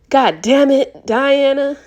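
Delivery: high, loud anguished yell; loud pained yell at his girlfriend. Acted. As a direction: yell it out in pain and fury. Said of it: "he yelled".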